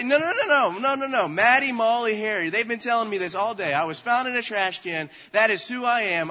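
high, loud hammed-up protest; frantic insistence. Child insisting to parents the siblings told truth, frantic. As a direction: protest like a worked-up child, overriding objections.

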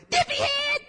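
put-on very high, loud toddler squeal; two-year-old daughter. Two-year-old copying a parent from the back seat, high toddler shout. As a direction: shout it like a tiny gleeful copycat. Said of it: "my two-year-old daughter go".

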